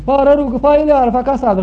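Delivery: put-on high, loud call to a girl. Punished boy calling a girl from outside the house, loud call. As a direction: shout her name from outside. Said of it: "strigă".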